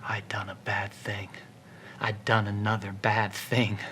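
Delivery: soft, low, scared whisper; frightened whisper. Acted. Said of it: "he whispered in fright".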